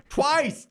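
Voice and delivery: high, punched twice; stressing that he yelled it twice; emphatic twice. Real, not acted. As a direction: hit twice hard and high. Emphatic recap of double yell.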